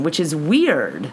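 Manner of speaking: ordinary delivery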